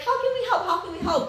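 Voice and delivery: high, laughing mock eagerness; eager quoting voice. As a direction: chirp it like an eager helper. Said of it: "a lot of people are like".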